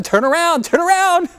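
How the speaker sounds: high, rising urgent call. Acted. Father to daughter riding off, urgent high call. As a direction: call it after someone riding away.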